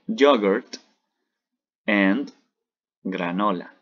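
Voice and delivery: "Yogurt and granola" said loud, word by word in English; modeling English words. Shown as a demonstration. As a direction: lay out "Yogurt", "and", "granola" for the class to repeat. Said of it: "repitan conmigo". ("Yogurt and granola" palabra por palabra para que repitan)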